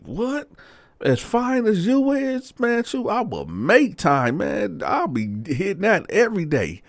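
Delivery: high-pitched, drawn-out disbelief; incredulous. Retold or imitated. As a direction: act out an astonished man, voice shooting up. Father-in-law acted, astonished, flirting.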